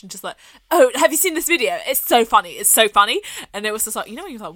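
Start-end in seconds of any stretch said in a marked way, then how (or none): 0.7-3.2 louder, higher mock posh gushing; loud posh girl. Posh girl on the tube to her boyfriend, loud mock gushing. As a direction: imitate a tipsy posh girl showing off. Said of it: "a bit drunk and a bit loud, but, like, she was really posh".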